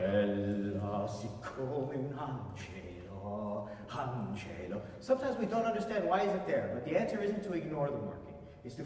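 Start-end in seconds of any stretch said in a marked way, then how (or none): 0.0-4.8 slow, low line, each syllable of "angelo" pressed; three accents on ANGELO. Shown as a demonstration. Coach shows singer the written accents, "angelo" stressed on every syllable. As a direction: lean separately on an-ge-lo in "angelo". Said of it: "It's all three".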